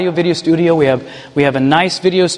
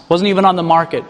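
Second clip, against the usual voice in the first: ordinary delivery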